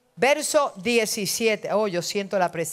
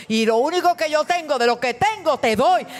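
raised, ringing ministry over music; fervent preaching; 5 semitones higher than usual. Acted. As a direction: pour it out on the congregation.

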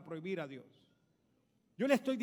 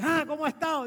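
high, loud, hearty hello; old pastor's greeting; 5 semitones higher, 7 dB louder than usual. Retold or imitated. Old pastor answering the windscreen washer, high loud greeting. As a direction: greet him loudly with a lifted pitch. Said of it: "Y el viejo le dice".

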